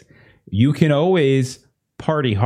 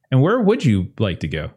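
ordinary talk, nothing marked

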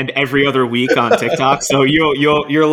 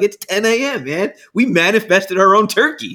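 excited disbelief; 8 semitones higher, 3 dB louder than usual. Retold or imitated. Shocked at ten AM kill.

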